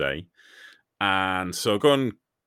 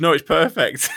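laughing; 8 semitones higher, 8 dB louder than usual. Retold or imitated. Retold staff laughing reply.

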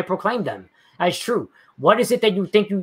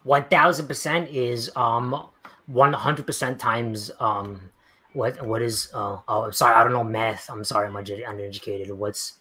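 mock dumb apology; 6 semitones lower than usual. Answering a viewer's math jab with mock helpless sarcasm. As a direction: play dumb, sarcastic and sheepish. Mocking himself as uneducated liberal.

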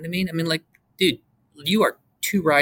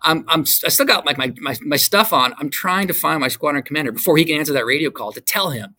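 normal talking voice

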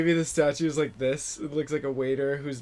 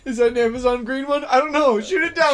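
much higher and louder, rushed and eager; excited yell; 10 semitones higher, 9 dB louder than usual. Acted. Neighbor spotting a cash drone, excited high yell. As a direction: hype it up like you just spotted loot in the sky.

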